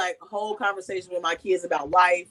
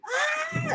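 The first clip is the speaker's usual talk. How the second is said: put-on tiny high squeal of a toddler's scream; little girl's scream; 4 dB quieter than usual. Mother imitating her angry daughter, a tiny high squeal. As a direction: squeak it out like a mad little girl. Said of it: "she'll go and do that little scream".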